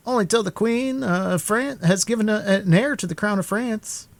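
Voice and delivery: mocking musketeer pitched well up; a sly young musketeer; high musketeer voice. A narrator's reading.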